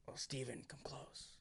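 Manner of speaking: whispered as if on deathbed; mock dying whisper. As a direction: fake a last breath to tease him. Teasing friend, playing near-death.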